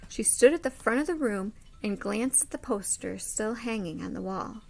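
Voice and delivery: expressive storybook read-aloud; lively picture-book narration; reading to children. Acted. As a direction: read it like story time for a class.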